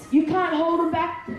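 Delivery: ordinary delivery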